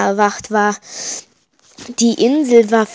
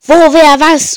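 frantic panicked shout, higher and louder; Nervenzusammenbruch; 5 semitones higher, 9 dB louder than usual. Acted. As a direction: break down screaming questions. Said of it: "Ich bekam einen Nervenzusammenbruch". (aufgelöst kreischend)

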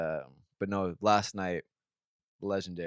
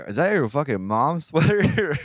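mocking heckler; 7 semitones higher, 6 dB louder, slower than usual. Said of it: "that one guy who's like".

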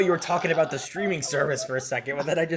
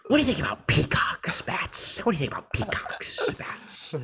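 half-whispered villain, pitched up; Joker voice; 6 semitones higher than usual. Acted. Podcaster as Joker questioning Batman, hammed-up whispery villain. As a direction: needle him in a high voice falling to a whisper. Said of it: "Joker walking up to Batman".

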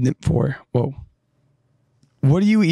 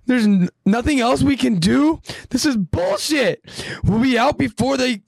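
much higher angry rant; pissed off; 14 semitones higher than usual. Acted. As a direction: vent in frustration. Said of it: "A pissed off Connie says".

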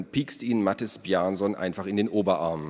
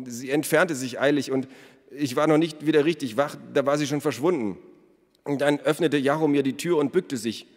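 higher-pitched, hurried confession; nervous accused smith; 6 semitones higher than usual. A narrator's reading. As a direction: confess anxiously in a raised voice. (nervöser Schmied, höhere Stimme)

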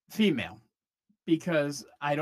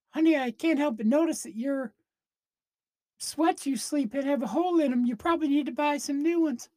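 higher, sweetly concerned voice; mock sweet partner; 7 semitones higher than usual. Imagined man fussing over his partner's sweats, high and sweet. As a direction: fuss over him like a caring spouse.

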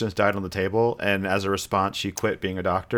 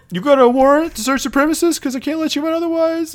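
much higher, louder squeaky doorman; 4 dB louder than usual. Retold or imitated. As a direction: play the doorman way up high, mock suspicious. Doorman voiced in retold scene.

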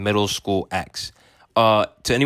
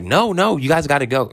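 high-pitched imitation of a pushy sister; insistent sister; 6 semitones higher than usual. Sister pushing the couple out the door, high and insistent. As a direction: pitch it up and urge them along.

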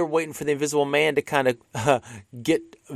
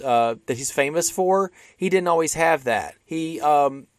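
usual speaking voice

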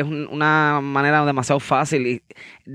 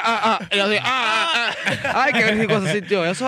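gemidos de placer imitados; 5 semitones higher than usual. Retold or imitated. Imitating his own moaning.

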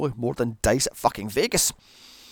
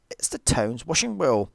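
usual speaking voice

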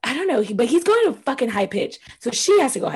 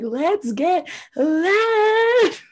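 very slow, stretched mock J Lo, higher; 8 semitones higher, much slower than usual. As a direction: drag every word out like a hammed-up diva. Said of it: "the clip of J Lo being like".

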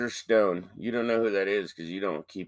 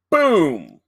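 loud mock gunshot boom; a loud imitated gunshot; 5 semitones higher, 8 dB louder than usual. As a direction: shout out the bang of the gun. Retold gunshot.

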